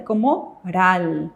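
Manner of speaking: ral said slowly, r at start, l at end; model reading. Shown as a demonstration. Teacher shows the class how to read it, slow. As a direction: draw out ral slowly and clearly. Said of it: "¿Cómo se va a leer entonces?".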